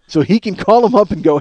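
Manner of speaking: normal talking voice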